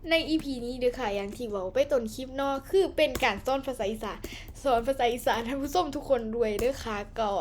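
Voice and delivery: chatty Isan dialect host talk. Acted. Host greeting viewers and starting the game, in Isan dialect. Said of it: "เริ่มพูดอีสานเลยปะ".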